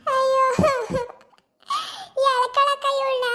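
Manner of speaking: high, drawn-out wail of pain. Acted. Beaten woman wails to the neighbours, high and crying. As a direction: cry out as if your back just broke.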